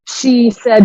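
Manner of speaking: normal talking voice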